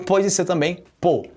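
normal talking voice

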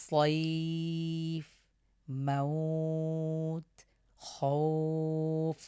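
ay and aw held long before the stop; very slow, sustained leen vowels; madd leen stretch. Shown as a demonstration. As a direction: draw out the diphthong on a level note, then close. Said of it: "ওয়াকফ করলে এক আলিফ টেনে পড়ব".

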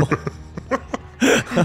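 high, airless wheezing laugh; real laughing fit; helpless laughter. Real, not acted. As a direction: laugh until the voice drops out.

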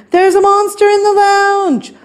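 high-pitched storybook alarm; panicked yell. A narrator's reading. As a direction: yell out the terrifying news. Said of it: "yelled Stanley".

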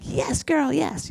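high-pitched sassy cheer; high girly "Yes, girl". Retold or imitated. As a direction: squeal it high like a girlfriend hyping you up. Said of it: "I would be like".

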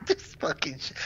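laughed through, almost breathless; laughing. Real, not acted. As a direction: let the giggle break the words. Laughing at the ugly house.

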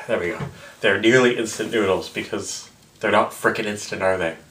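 ordinary delivery

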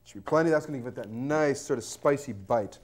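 ordinary delivery

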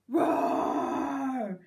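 big drawn-out roar; loud roar. Acted. Girl roaring at a squirrel, loud roar. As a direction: roar like a lion at full volume.

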